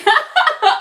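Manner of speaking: high delighted laugh; giggly high laugh; tickled laugh. Real, not acted. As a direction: burst out laughing at the chat. Santa is singing to me.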